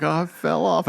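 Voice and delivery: high, pitiful imitation of a crying child; mock tearful. Son tells mum he fell, mock crying. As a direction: whimper it lightly as the hurt kid. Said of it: "I'm crying".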